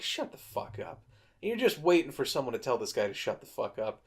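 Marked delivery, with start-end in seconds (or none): 0.0-1.0 breathy whisper under the breath; whispered aside. Retold or imitated. Viewer whispering at Pony to shut up. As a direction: hiss it to yourself, barely voiced.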